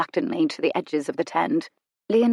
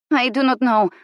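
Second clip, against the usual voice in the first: sullen; 7 semitones higher, 4 dB louder than usual. A narrator's reading. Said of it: "Martha said sullenly".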